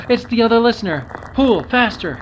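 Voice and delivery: high, loud, thrilled; excited discovery. Pretend. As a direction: shout with excitement. Sees listener on rope.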